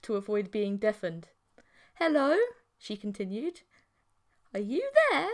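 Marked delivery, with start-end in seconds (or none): 2.0-5.3 high, louder sing-song questions; chirpy creature girlfriend. A narrator's reading. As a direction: call brightly down the line.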